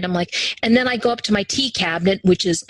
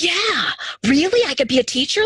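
surprised delight; 8 semitones higher than usual. Retold or imitated. Answering Linda's offer to teach, high and amazed. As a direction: pitch up in disbelief at the offer. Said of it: "And I was like".

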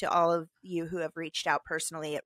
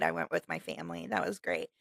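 ordinary talk

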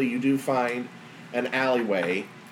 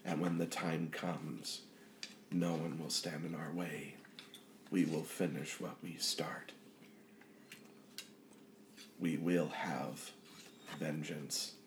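vengeful inner presence; 5 semitones lower, 12 dB quieter than usual. Acted. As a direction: sink to a near-whisper, deliberate and ominous.

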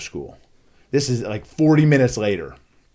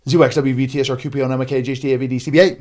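very fast, loud alphabet rattle; speed-run the letters in one burst; rapid-fire recital; 3 semitones higher, 6 dB louder, much faster than usual. Shown as a demonstration. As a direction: race through it without a breath. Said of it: "And then I go".

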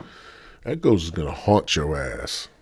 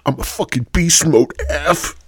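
higher, louder hype shout; hyped boast; 9 semitones higher, 5 dB louder than usual. Acted. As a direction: pump yourself up.

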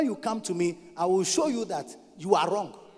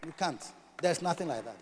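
ordinary talk, nothing marked

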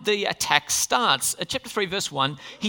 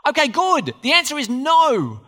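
louder, calling it out to the church; loud emphatic answer; 5 semitones higher, 5 dB louder than usual. Real, not acted. Preacher to the church, calling out loud and firm. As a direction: proclaim the answer with force.

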